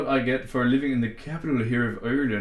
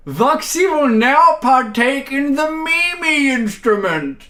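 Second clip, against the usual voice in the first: high, loud, pompous presenter voice; mock announcer; 10 semitones higher, 7 dB louder than usual. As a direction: announce it like a grand stage act. Grand mock announcement to chat.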